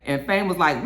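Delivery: ordinary talk, nothing marked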